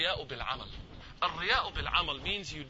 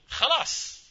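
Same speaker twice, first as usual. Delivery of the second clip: "Khalas" called out loud and firm; emphatic khalas; 9 semitones higher, 5 dB louder than usual. Shown as a demonstration. Teacher calling out "Khalas" to show a finished thing, loud. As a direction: slam down "Khalas" like finishing something. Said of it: "Clean break".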